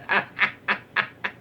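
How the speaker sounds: a high, breathy burst of giggling; real giggle fit. Real, not acted. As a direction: crack up at your own punchline. Streamer cracking up at own story.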